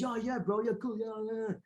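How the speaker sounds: high, soft mock surfer-dude voice; Keanu Reeves. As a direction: exaggerate a laid-back bro agreeing. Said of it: "I make him sound like Keanu Reeves".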